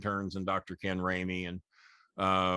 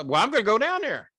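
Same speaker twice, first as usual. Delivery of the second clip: a fan sold on the promo, voice pitched up and louder; hyped-up fan; 11 semitones higher, 7 dB louder than usual. Retold or imitated. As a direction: play the believer announcing he's off to Kroger. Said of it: "He'd make you believe it".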